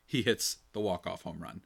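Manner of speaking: usual speaking voice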